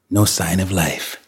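usual speaking voice

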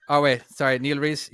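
usual speaking voice

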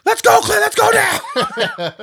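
high, loud hammed-up shout, laughing through it; mock yell. Scrooge yelling at Clint to go, hammed-up high shout. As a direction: bellow at him to hurry up.

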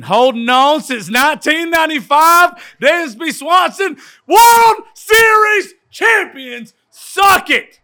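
very high, loud celebratory scream; eardrum-busting shout. Real, not acted. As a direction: scream it out as your team wins the title. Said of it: "If I just busted your eardrums".

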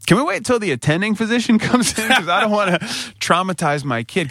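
high-pitched, insistent pleading; protesting parent, reenacted high; retold urgent protest. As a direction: replay an urgent plea, pitched well up. Retelling a protest to medics.

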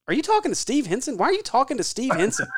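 high, incredulous questioning; surprised. Retold or imitated. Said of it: "I'm like".

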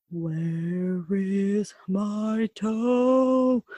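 slow drawn-out moaning voice; groaning ghost. Acted. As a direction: moan it very slowly, like a ghost. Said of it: "the voice groaned".

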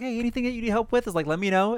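a lightly voiced shop assistant; lightly put-on helpful clerk voice; customer service voice.

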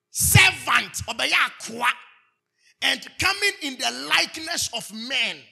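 loud, high, fervent sermon climax; full-force preaching. Acted. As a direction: shout the scripture to the congregation, slow and swelling.

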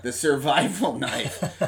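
slow, amused, breaking into a laugh. Real, not acted. Host laughing while naming Rambo's knife, amused. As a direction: chuckle through the word.

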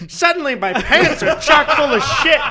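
high loud quote through laughter; acting it out while cracking up; laughing. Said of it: "Jamie walks in".

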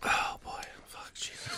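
whispered aside; whispering. Real, not acted. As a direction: breathe it under your breath. Muttered aside under breath.

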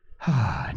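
heavy, falling sigh; storyteller's acted sigh of trouble; dismayed sigh. A narrator's reading. Polly dismayed, reader sighs.

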